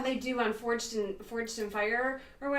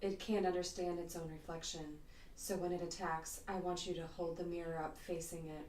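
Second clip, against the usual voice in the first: low, soft sultry woman; 3 semitones lower, 8 dB quieter than usual. Acted. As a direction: purr it like a seductive heroine. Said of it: "picture her with a little bit more of a sultry voice".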